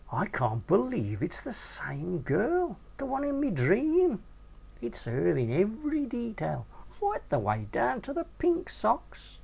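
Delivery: starstruck, pitch leaping up; excited disbelief. A narrator's reading. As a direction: gush about the dream girl, voice climbing high. Narrator's younger self, lovestruck.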